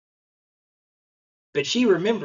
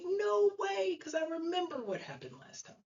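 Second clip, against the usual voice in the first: put-on very high little-kid voice, trailing softer; imitated protesting child; 12 semitones higher, 7 dB quieter than usual. Daughter refusing dad's help with next splinter, imitated child's protest.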